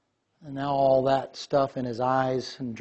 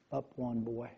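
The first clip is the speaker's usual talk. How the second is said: soft command; 2 semitones lower, 10 dB quieter than usual. Retold or imitated. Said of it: "He said".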